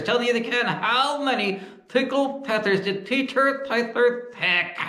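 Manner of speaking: squeaky puppet asking back; high dummy voice. Acted. Dummy voice asks.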